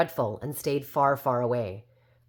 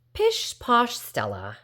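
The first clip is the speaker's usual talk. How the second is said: high, slow, scornful brush-off; dismissive older sister cutting in; scoffing dismissal; 8 semitones higher, slower than usual. Acted. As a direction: wave her sister away with a sniff. Edna scoffs at her sister.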